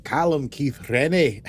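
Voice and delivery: slow Scottish "Rennie" with rolled R; deliberate, practised Scottish name; Scottish accent. Shown as a demonstration. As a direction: attempt a Scottish accent, trilling the R. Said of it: "The R's are hard".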